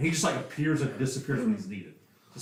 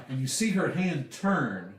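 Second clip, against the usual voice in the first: ordinary talk, nothing marked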